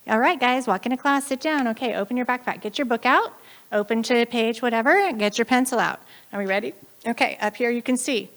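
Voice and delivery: brisk teacher. Acted. Teacher telling the class to get books out, brisk. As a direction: rattle off orders to a class.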